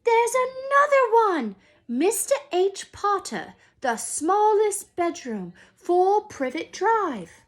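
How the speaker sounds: put-on loud, high yelling child; high, loud kid's holler; excited shout. A narrator's reading. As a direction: shout it down the hall, thrilled. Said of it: "Then he shouted".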